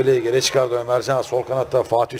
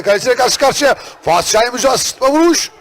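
excited; 8 semitones higher, 5 dB louder than usual. Real, not acted. Chance building in commentary.

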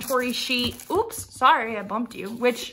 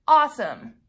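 louder mock-cheerful awesome, falling; sarcastic; 2 semitones higher, 4 dB louder than usual. Real, not acted. To her viewers, after the wrong kit, loud sarcastic cheer. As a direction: fake enthusiasm, spelled out with a sigh behind it.

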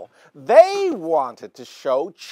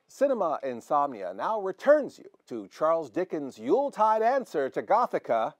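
ordinary talk, nothing marked

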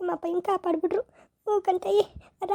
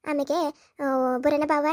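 ordinary delivery

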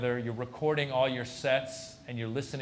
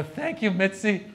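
higher, louder, drawn-out gratitude; thrilled thanks; 6 semitones higher, 5 dB louder than usual. Acted. Comic thanking club owner for regular spot, thrilled. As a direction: thank her eagerly, pitched up and stretched.

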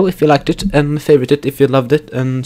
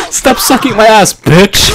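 angry yell; 7 semitones higher, 7 dB louder than usual. Real, not acted. Yelling at the game.

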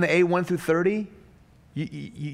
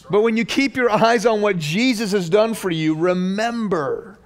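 louder, higher fervent preaching; preaching at full force; 7 semitones higher, 5 dB louder than usual. Acted. Pastor presses the congregation on entitlement, fervent preaching. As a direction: drive it home to the church.

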